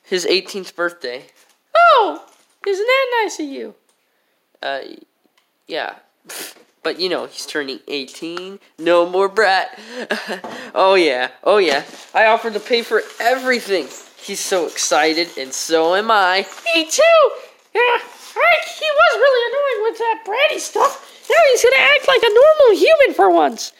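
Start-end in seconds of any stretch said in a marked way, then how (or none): 1.8-3.8 put-on squeaky high kid voice; sweetly high cartoon voice; high character voice. 16.7-23.7 put-on squeaky high kid voice; giggly high cartoon kid; high character voice.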